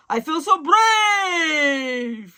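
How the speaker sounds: loud, slow, triumphant roar; lion's shout. Acted. Lion after the potion, loud slow triumphant shout. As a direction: bellow it like a lion newly brave. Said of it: "he cried".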